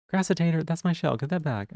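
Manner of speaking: ordinary talk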